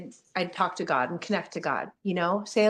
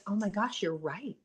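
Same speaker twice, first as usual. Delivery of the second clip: softer, sheepish admission; embarrassed realization; 6 dB quieter than usual. Retold or imitated. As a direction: drop the voice as it dawns on you. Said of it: "And I was like".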